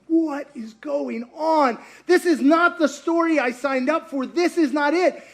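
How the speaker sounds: high, slow dismayed disbelief; bewildered. Retold or imitated. Peter watching Jesus taken away, dismayed and bewildered. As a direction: let it sink in that it's all wrong.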